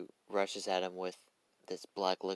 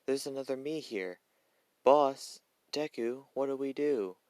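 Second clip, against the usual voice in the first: high-pitched appeal to the boss; acted high-voiced alarm; panicky Toga; 6 semitones higher, 5 dB louder than usual. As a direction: call out higher, with a loud burst on the first address. Two Togas appeal to Deku.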